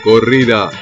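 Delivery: ordinary talk, nothing marked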